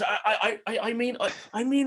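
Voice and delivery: high, flustered stammer; caught out. Acted. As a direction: stammer excuses like someone just caught. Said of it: "He goes".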